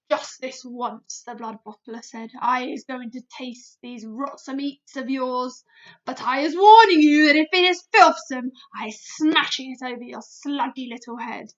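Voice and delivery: agree, then threaten; grudging giant. A narrator's reading.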